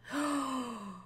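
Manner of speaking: soft gasp of delight. Real, not acted. Seeing a canopy bed, delighted little gasp.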